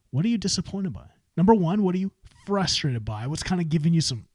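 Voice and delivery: ordinary talk, nothing marked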